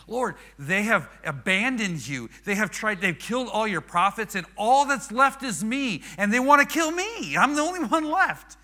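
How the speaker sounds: high, loud complaint climbing to a peak; hammed-up wail of the last one left; Elijah's building lament. Acting Elijah's escalating complaint to God.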